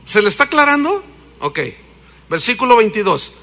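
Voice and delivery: ordinary talk, nothing marked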